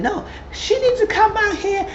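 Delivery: high mock insistence; mock mom. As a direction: whine it in a high pushy voice.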